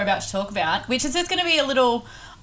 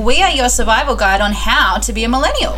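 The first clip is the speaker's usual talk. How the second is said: upbeat show intro; 9 dB louder than usual. Acted. Podcast intro jingle.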